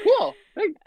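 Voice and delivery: very high, polite squeak; awkward chirpy. Retold or imitated. Her reply retold.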